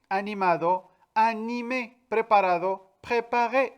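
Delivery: teacher reading a list of word pairs; Spanish and French word pairs. Shown as a demonstration. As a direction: contrast Spanish ado with French é, one pair at a time. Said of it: "Por eso tenemos".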